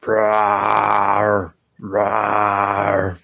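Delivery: low rumbling monster rawr; huge fiery dragon roar. Pretend. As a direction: roar like a big beast. Playful roar after host introduces [name].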